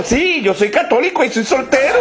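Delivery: eager, high-pitched taxi driver; excited. Acted. Taxi driver answers the nun's conditions, excited. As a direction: blurt it out with rising eagerness. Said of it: "El taxista respondió emocionado".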